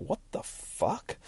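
slow, drawn-out, hushed disbelief; baffled disbelief. Acted. Heroine answers the smug villain, slow hushed bafflement. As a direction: stretch it out softly, stunned and baffled.

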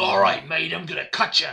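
loud, hammed-up Australian accent; punchy cartoon Australian; Wolverine, Australian accent. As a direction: threaten it in a broad mock-Australian voice. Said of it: "was, like, Australian".

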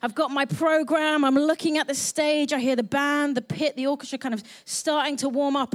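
usual speaking voice

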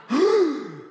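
sharp high gasp of shock. Acted. Preacher acting shock, gasping. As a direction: gasp as if betrayed.

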